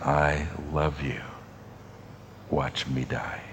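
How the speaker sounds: quiet, slow, gentle voice of love; tender and soft. Pretend. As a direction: let it fall soft and tender. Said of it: "and saying".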